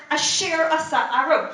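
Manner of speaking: usual speaking voice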